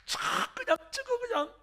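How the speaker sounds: high, wailing plea through tears; crying prayer. Acted. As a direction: cry out to God in grief. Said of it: "울면서 기도합니다".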